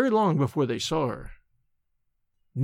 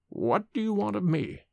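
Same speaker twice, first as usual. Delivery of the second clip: a hint of a dying man, soft and hollow; hollow words; 4 semitones higher, 4 dB quieter than usual. A narrator's reading. Dying man to the old nurse, hollow. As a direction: say it hollow, spent. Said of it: "each word struck hollow".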